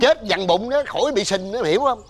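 high, exasperated explanation; exasperated retort. Acted. Older man explaining himself to a doubting neighbour, exasperated.

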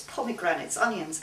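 plain reading voice

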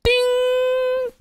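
very high, sustained ding; imitated bell ding. Streamer voicing a box opening, high bell ding. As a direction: ring out like a bell.

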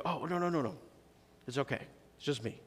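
soft, gentle oh no it's just me; reassuring. Pretend. Calming someone he startled, softly reassuring. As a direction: ease a startled friend softly.

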